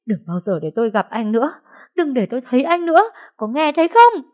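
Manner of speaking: high, loud tearful screaming; wife screaming at husband to leave; sobbing outburst. Acted. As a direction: drive him away through sobs. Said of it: "khóc nức nở như một đứa trẻ".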